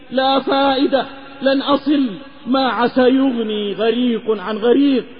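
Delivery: ordinary delivery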